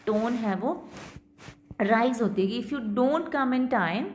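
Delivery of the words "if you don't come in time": "If you don't come in time" slow, rising at the end as unfinished; rising non-final tone. Shown as a demonstration. Teacher shows students an unfinished clause, slow and rising. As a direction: hold it open and lift "time". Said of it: "देखो rise होती है".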